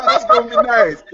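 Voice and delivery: high, bright-eyed fake enthusiasm; mock sweet high voice. Telling his friend her trip sounds nice, in a squeaky high voice. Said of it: "And I was like".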